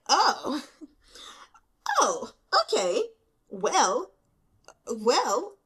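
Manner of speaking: loud, high, stammering shock; lost for words, sputtering; flustered shock. Real, not acted. Reader reeling from the twist, loud sputtering shock.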